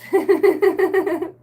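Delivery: high burst of laughter. Real, not acted. Cracking up at her own accent joke, high laugh.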